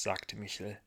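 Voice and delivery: plain reading voice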